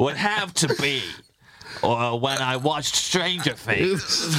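slow, drawn-out American voice; American accent. Shown as a demonstration. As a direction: put on an American accent and take it slowly. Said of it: "that's my American voice".

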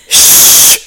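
voiceless hiss of a shush, finger to lips; sharp shush. Retold or imitated. Woman in the shop shushing the boy, loud sharp shush. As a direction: hush the child with a loud, sharp shh. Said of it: "vänder sig den här kärringen om och bara".

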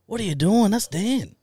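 high-pitched, loud retold manager, surprised. Manager scolding the guard, high and loud. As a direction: squeak it out like a shocked female manager. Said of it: "his manager comes out and she goes".

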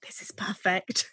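hushed reverent whisper; awed whisper to herself; whispered awe. Retold or imitated. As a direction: breathe it out in awe, slowly. Gushing over the movie.

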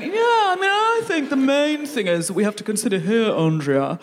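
a hint of a blustering man at work; lightly mock a man holding forth; mildly hammed-up meeting blowhard; pompous man in a meeting. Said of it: "opening their mouth and going".

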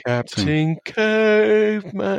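high, drawn-out cartoon cry; Captain Caveman. Co-host breaking into the cartoon catchphrase, long high-pitched call. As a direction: stretch it out like a cartoon hero's call.

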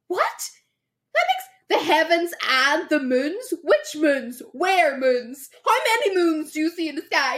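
high, loud baffled questioning; incredulous. Pretend. Girlfriend mocks the boyfriend's moon vows in high, loud disbelief.

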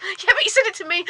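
high-pitched, eager retort; retold giddy insistence. Retold insistence to her producer.

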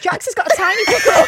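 loud, high laughing tease. Real, not acted. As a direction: crack up while teasing. Teasing laughter at friend.

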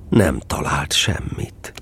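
normal talking voice

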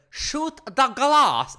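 hammed-up slow villain yell; mock villain shout. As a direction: bellow it slowly like a fed-up villain. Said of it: "And then he finally said".

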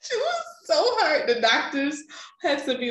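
high, giggling through the words; laughing. Real, not acted. Telling a friend about her mom, laughing high. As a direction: laugh while saying it.

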